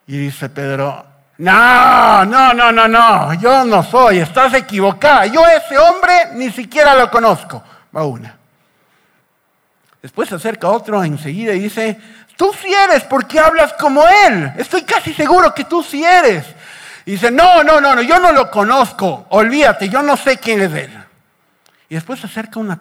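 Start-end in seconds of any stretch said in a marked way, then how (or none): 1.4-7.6 loud, high, hammed-up protest; raised-voice panicky denial, performed; acted vehement denial. Acted denial by accused Peter. 12.3-16.5 high, loud finger-pointing accusation; played-up confrontation at raised pitch; acted accusation. Acted second accuser confronting Peter. 17.3-21.0 loud, high protest, easing down; performed emphatic denial; acted flustered denial. Acted second denial by Peter.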